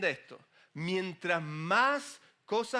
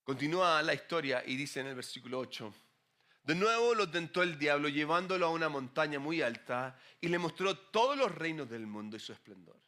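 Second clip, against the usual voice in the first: ordinary talk, nothing marked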